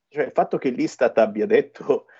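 ordinary delivery

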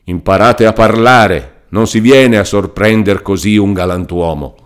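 loud, indignant scolding; furious lawyer dismissing Renzo; shouting lawyer. Acted. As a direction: drive the fool out of the room. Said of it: "sempre gridando".

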